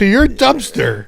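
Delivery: high, loud and slow, full of delight; joyful slow exclamation, pitched high; laughing wonder. Real, not acted. S1 marveling, laughing.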